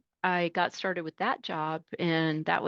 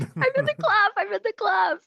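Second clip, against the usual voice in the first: very high, loud squeal of delight; thrilled driver; 5 dB louder than usual. Retold or imitated. New driver thrilled at being called driver, high squeal. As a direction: squeal the word like a kid with a prize. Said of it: "I was like".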